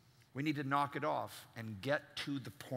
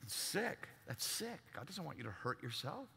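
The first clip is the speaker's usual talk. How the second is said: quiet disgust; 10 dB quieter than usual. Real, not acted.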